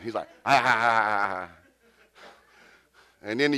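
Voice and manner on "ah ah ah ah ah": repeated stammered "ah"; mock stuck-on-a-sound stutter; imitated stutter. American guy unable to answer, imitated stammering. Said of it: "but he started stuttering".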